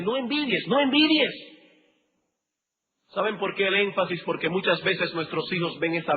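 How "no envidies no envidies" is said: "no envidies" repeated, the second time higher and louder; rising insistence on "envidies"; building emphasis. Shown as a demonstration. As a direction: hammer "no envidies" harder each time. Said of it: "el énfasis de".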